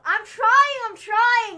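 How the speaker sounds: high, loud, defensive cry; loud protest. Real, not acted. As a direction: protest loudly that you're making an effort. Defending her accent attempts.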